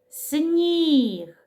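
drawn-out teaching "Сніг", lingering on і; sounding out. Shown as a demonstration. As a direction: stretch the vowel in "Сніг". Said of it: "Звук і".

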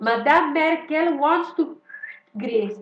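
put-on slow, halting, Greek-accented English speech. Imitating Tsipras addressing Merkel in slow Greek-accented English. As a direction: stumble through it like a clumsy statesman. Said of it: "να βγει ο Τσίπρας και να πει".

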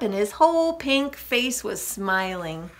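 ordinary delivery

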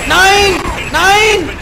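berserk rage. Real, not acted. Raging at game events.

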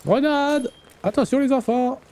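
high shouted warning; grenade warning yell. Real, not acted. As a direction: yell it to warn the team. Warning teammates in shootout.

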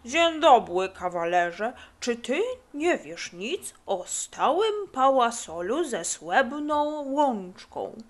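exaggerated mush-mouthed impression; funny stranger imitation. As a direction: talk as if with dumplings in the mouth. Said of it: "naśladując nieznajomego".

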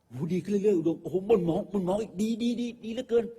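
hammed-up director praising the second take; fawning director. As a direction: rave over the take like a besotted director. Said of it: "เล่นให้แม่งเทคที่สอง".

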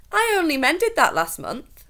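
puzzled protest. A narrator's reading. Dovetail protesting.

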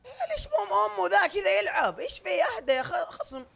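very high, loud whining protest; outraged wail. Real, not acted. Player protesting to friends about the opponent's play, outraged wail. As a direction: wail in disbelief at how he plays.

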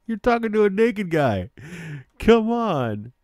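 high, loud, breaking out of laughter; amused disbelief. Real, not acted. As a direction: protest loudly while cracking up. Cracking up at naked game character.

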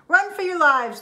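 frantic call to flee; panicked shout. Acted. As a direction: yell to warn everyone.

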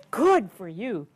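plain reading voice

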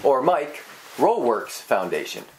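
"Mike" set apart, pause, then "Rowe Works Foundation"; regrouped name with pause. Shown as a demonstration. Host trying another grouping, pause between "Mike" and "Rowe Works". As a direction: break after "Mike", keep "Rowe Works" together. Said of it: "I don't know if they're hyphenated".

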